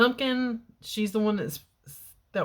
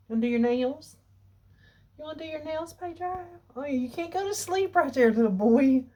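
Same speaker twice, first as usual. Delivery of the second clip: much higher playful pet talk; 9 semitones higher than usual. Real, not acted. Owner asking Pedro if he wants his nails done, high baby talk. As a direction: tease the cat sweetly.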